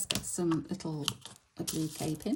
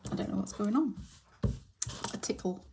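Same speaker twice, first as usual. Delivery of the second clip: usual speaking voice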